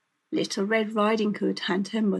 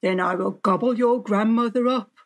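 scheming wolf; 5 dB louder, faster than usual. A narrator's reading. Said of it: "thought the wolf".

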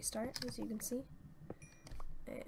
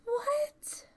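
high and loud, breaking off in amazement; stunned disbelief; 9 dB louder than usual. Real, not acted. Player receiving a gift, high stunned squeal. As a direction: squeak it out, unable to believe it.

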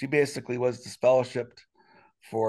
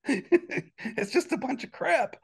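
slow, high laughing complaint; laughing off a bitter point; 11 semitones higher, much slower than usual. Real, not acted. Laughing to the listener about the elders' rule.

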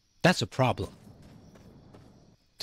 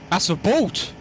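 excited; 5 semitones higher than usual. Real, not acted. Spotting the boat, repeated.

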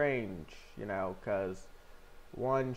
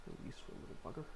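whispering; 15 dB quieter, much faster than usual. Real, not acted. Affectionate to gold.

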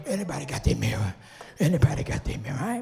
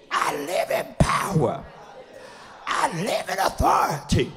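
loud, high preacher's shout, phrase by phrase; full-force preaching declaration; 6 semitones higher, 5 dB louder than usual. Real, not acted. Preacher leading the church in speaking fearlessness, shouted declaration. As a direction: proclaim each phrase for a congregation to echo.

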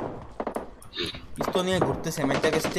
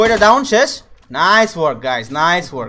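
loud, pumped praise; excited cheer; 2 semitones higher, 11 dB louder than usual. Real, not acted. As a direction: cheer the squad on loudly. Cheering team after kills.

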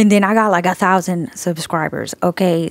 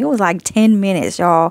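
ordinary delivery

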